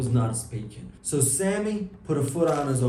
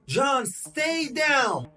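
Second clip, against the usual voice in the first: high, drawn-out call to stay down; urgent warning call; 11 semitones higher, much slower than usual. Acted. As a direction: call out slowly and high to warn him. Sammy calls warning in gunfight.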